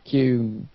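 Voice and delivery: Kuhn as "cue" with an n behind it; name pronunciation demo. Shown as a demonstration. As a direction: sound out Kuhn as one syllable, "kyoon". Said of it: "put an N behind it".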